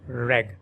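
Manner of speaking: reg said alone, the stressed syllable of oregano. Shown as a demonstration. Teacher isolating the stressed syllable reg. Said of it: "with the stress on the second syllable".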